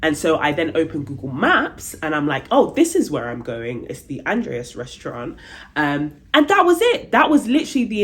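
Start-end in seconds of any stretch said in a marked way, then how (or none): none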